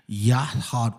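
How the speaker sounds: "Yahad-" broken off into a breath; "Yahad-" as yat-ha, trailing into an airy puff; silent final A. Shown as a demonstration. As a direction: say "Yahad-" and let the ending turn to breath. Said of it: "almost like a silent A".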